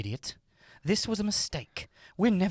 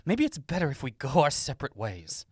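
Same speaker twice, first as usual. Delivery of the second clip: usual speaking voice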